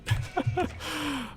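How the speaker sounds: quick high-pitched laugh; short high laugh. Real, not acted. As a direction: let out a squeaky laugh. Laughing after losing boar.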